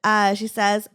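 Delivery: ordinary talk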